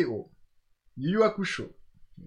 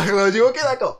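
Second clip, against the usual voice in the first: louder laughing repeat of ok d'accord; 8 semitones higher, 7 dB louder than usual. Real, not acted.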